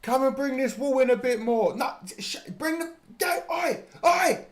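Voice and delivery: high, loud shouting of a cross workman; mock builder shouting. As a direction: bark orders across the site like an angry geezer. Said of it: "That's what he's shouting at him".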